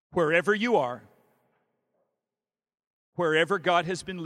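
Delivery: slow, loud preaching blessing; benediction. Acted. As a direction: send them out with a rising blessing. Closing benediction over the congregation.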